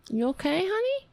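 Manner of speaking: high cooing pet voice; tender checking. Real, not acted. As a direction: rise high on a doting question.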